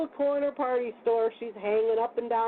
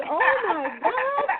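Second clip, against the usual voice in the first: a high, slow, crying "oh my God"; imitating shocked cashier; 11 semitones higher, 3 dB louder, slower than usual. Store cashier reacting to the truck crash, mock shocked wail. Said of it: "she said".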